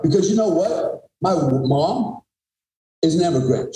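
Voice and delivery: usual speaking voice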